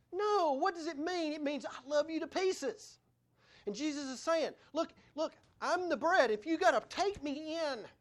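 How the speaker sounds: high, insistent sermon voice; impassioned preaching. Acted. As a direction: press the point on the congregation.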